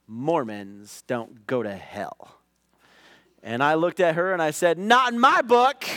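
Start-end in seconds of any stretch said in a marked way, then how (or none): 0.0-2.4 quiet, slow, matter-of-fact reply; a lightly voiced classmate, quiet and slow; flat retort. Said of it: "she said to me straight up". 4.8-5.9 loud, high snap-back; retold defiant comeback; defiant retort. Final retort to Nicole.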